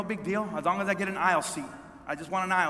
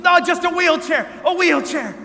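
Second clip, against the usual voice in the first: loud, high imitated cry; pleading shout; 9 semitones higher, 8 dB louder than usual. Injured woman begging for a wheelchair, crying out. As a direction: call out desperately, as if hurting.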